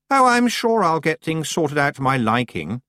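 high, breezily superior voice; self-confident. A narrator's reading. Anagramma turning down Tiffany's help, self-confident. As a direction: dismiss the offer with lofty assurance. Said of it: "boundless self-confidence".

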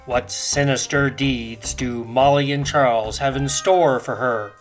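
ordinary talk, nothing marked

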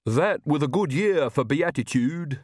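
slow, high-pitched lisping servant voice; odd, lisping henchman proclaiming; Igor, the servant. A narrator's reading.

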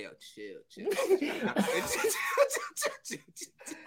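quick hushed string of pleading, half laughing; mock panicky plea. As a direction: beg the driver behind to back off. Pleads with driver behind.

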